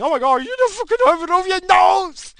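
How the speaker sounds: high, shrill mock outrage; mocking Karen. Mimicking a Karen who spots his uncovered nose, shrill mock outrage. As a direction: sneer at the mask scold in her voice.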